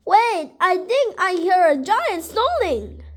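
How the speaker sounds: high, swooping storybook alarm; alarmed excitement. A narrator's reading. Rabbit warning Lion of a giant, high excited alarm. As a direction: warn with big pitch swings.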